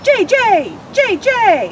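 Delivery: high, loud sharp jay calls; loud high jay imitation; blue jay shriek. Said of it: "Blue jay's shriek is as sharp as a drill".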